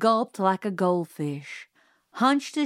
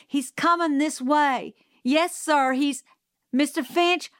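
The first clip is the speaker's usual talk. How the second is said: higher, raised, pressing the warning; urgent shouting; 6 semitones higher than usual. A narrator's reading. Calpurnia warning Mister Finch of a mad dog, raised and urgent. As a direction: push the alarm through the phone. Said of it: "she shouted".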